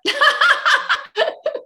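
bursts out in a loud high laugh; big laugh at the joke. Real, not acted.

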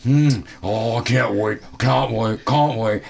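hungry giant, can't wait; excited monster. Acted.

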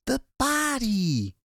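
high, drawn-out cry of alarm; panicked high shout; frantic shout. A narrator's reading. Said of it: "Ed shouted".

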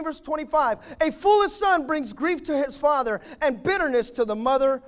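high, loud pulpit preaching, punching each line; preaching at full force. Acted. Preacher to the fathers in church, full-force preaching. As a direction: thunder the Proverbs at the congregation.

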